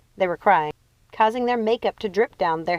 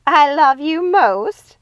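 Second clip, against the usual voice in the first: laughing; 8 semitones higher, 9 dB louder, much slower than usual. A narrator's reading. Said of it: "Lucinda laughed".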